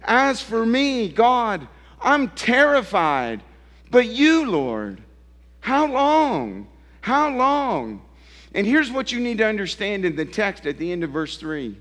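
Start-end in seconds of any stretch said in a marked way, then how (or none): 0.0-8.0 high, louder pleading cry to God. Retold or imitated. Preacher voicing David crying out to God, high and pleading. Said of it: "he cries out and he says".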